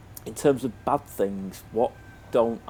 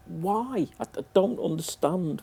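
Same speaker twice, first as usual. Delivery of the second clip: exasperated; 9 semitones higher, slower than usual. Real, not acted. Exasperated gripe at developers.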